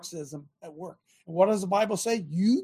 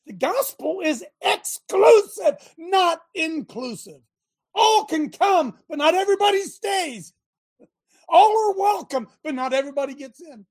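full-force preaching; 8 semitones higher, 5 dB louder than usual. Acted. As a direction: preach it up to the back pew.

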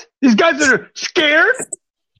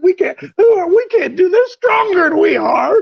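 scared spies; 7 semitones higher than usual. Retold or imitated. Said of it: "the men that went up with him said".

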